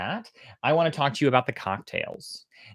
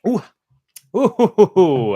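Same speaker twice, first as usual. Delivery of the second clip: loud, high ooh breaking into a laugh; a startled ooh with a laugh; surprised ooh; 8 semitones higher, 10 dB louder than usual. Real, not acted. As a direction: react to the strong whiskey. First sip of strong cocktail.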